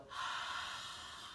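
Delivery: open-mouthed hah exhale, fogging glasses; ocean throat breathing. Shown as a demonstration. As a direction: breathe out to fog a lens. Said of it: "and they'll go".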